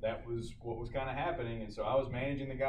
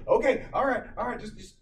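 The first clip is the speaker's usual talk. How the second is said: higher-pitched flustered stammer, imitated; nervous medic; 8 semitones higher, 4 dB louder than usual. Nervous student medic before watching doctors, flustered. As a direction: stumble through it as a rattled student. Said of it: "just nervous 'cause all these doctors".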